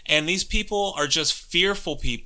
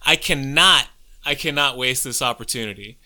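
ordinary talk

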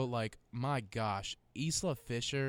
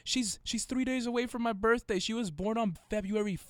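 high-pitched excited discovery; amazed, pitched way up; excited amazement; 8 semitones higher than usual. Real, not acted. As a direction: gush in a much higher voice. Amazed by near-matching birthdays.

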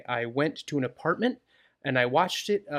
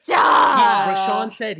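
indignant shout; 8 semitones higher, 11 dB louder, much slower than usual. Real, not acted. Protests losing first place.

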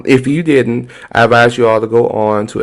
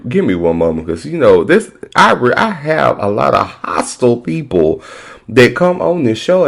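usual speaking voice

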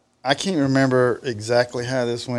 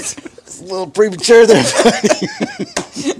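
high, loud, laughing tease; cracking up while teasing; 11 semitones higher, 9 dB louder, slower than usual. Retold or imitated.

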